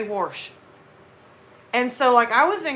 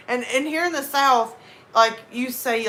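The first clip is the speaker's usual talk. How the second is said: ordinary talk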